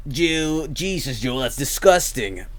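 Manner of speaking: a hint of a disgusted beer expert, slow; mock beer snob. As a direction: sniff at it like a snobby expert. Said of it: "all the beer experts come out".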